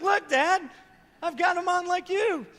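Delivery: put-on high, loud excited child voice; proud little boy. Son showing off his handcuffs to dad, high and excited.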